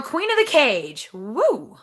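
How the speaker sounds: ordinary talk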